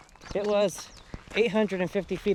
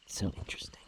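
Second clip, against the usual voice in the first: wildlife watching whisper; 10 dB quieter, faster than usual. Real, not acted. Whispering near beaver lodge.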